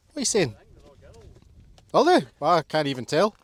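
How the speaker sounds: high, loud pet talk. Real, not acted. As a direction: fuss over the dogs. Chatting to the dogs.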